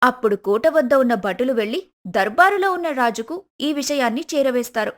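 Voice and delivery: usual speaking voice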